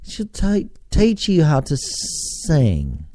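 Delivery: a playful stab at a British accent; attempted British accent. Shown as a demonstration. Plugging a UK singing teacher in a mock British accent. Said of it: "doing a U K accent or not".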